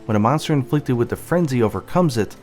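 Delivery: ordinary talk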